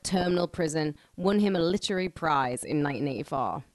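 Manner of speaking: normal talking voice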